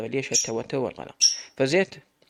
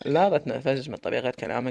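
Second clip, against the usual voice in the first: ordinary delivery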